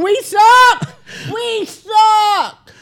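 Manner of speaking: shouting. Pretend. Walking through Vegas, chanting at passersby loudly. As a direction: yell it out on the street. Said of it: "Did I just yell that really loud?".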